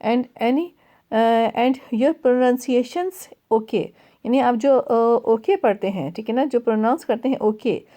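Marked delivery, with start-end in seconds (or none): none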